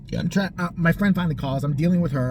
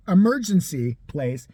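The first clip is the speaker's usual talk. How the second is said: ordinary talk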